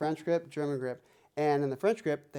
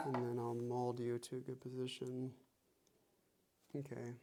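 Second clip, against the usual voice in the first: ordinary delivery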